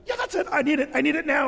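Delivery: snatch at it like a desperate man; David eagerly demanding Goliath's sword from the priest; eager grab. Retold or imitated.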